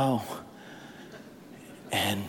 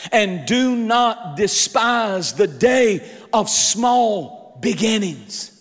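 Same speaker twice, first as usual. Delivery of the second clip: slow, weighty delivery; slow emphasis; slower than usual. Acted. Preacher reads scripture to congregation, slow and weighty. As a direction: lean on every word slowly.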